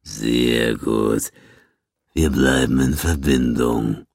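low, slow villain voice; Gans, menacing boss. A narrator's reading. Gans addresses the beaten Quinn, low and slow. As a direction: draw it out low and deliberate.

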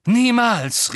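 loud and high refusal; shouting. A narrator's reading. Harry refusing Hermine, loud. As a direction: fling it back defiantly. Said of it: "rief Harry".

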